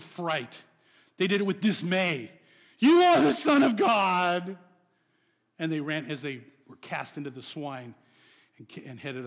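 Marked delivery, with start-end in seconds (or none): 2.8-4.6 loud, higher, strained cry of fearful demons; terrified demons. Acted. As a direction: cry it out like a cornered demon in terror. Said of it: "They did it with terror".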